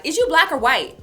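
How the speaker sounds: high, loud mock questioner; quoted questioner. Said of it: "I got the question all the time".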